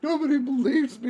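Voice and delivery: high-pitched mock wail through laughter; mock crying. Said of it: "He starts crying".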